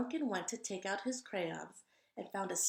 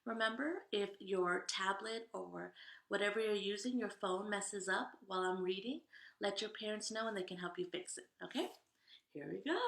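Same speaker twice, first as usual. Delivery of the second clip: ordinary delivery, nothing marked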